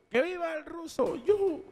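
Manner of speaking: high, small mock cheer; vítores fingidos. Cheer dying down. (viva agudo y bajito)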